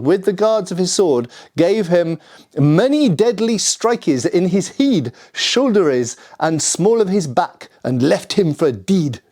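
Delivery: reading the old text in a hammed-up Scottish accent; mock Scottish accent. Shown as a demonstration. Reading a 1607 Scottish court record to viewers, in a mock Scottish accent. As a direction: roll it out like a bad Scottish accent, half-laughing at the end. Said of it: "that's not me doing a bad Scottish accent".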